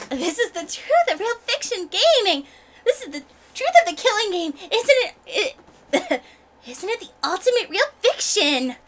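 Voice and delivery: high, animated character voice; excitable game villain. Acted. Villain revealing the truth of the game, high and excitable. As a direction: declaim it high with relish.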